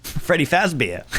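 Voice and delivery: slow, light mock Australian accent on "Freddy Fazbear". Streamer mimicking the game's name in a hammed-up Australian accent. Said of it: "in an Australian accent".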